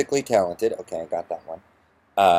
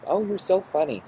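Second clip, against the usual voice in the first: mock gushing; 10 semitones higher than usual. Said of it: "how I'm".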